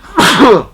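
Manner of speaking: sudden high sharp sneeze; sneezing; loud sneeze. Real, not acted. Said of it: "excuse me, I'm gonna sneeze".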